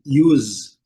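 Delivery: "use" dito iuz, com i inicial; English use. Shown as a demonstration. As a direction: say "use" as yooz. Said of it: "do U com som de iu". ("use" pronunciado iuz, com som de Z)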